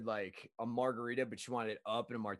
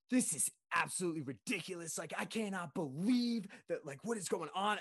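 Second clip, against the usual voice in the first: higher-pitched mock outrage, stretched on believe; grandmother freaking out; 6 semitones higher than usual. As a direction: imitate an indignant customer freaking out. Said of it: "freaking out".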